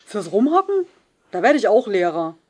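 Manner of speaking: the pupil jeers at the trainee teacher; cheeky shout. Acted. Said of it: "rief Kenans Banknachbar".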